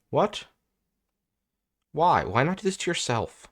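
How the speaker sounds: can't believe the opponent's play; baffled disbelief. Real, not acted.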